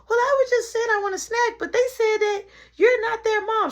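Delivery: put-on a high tattletale child; tattling little brother. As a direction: tattle like a smug little kid.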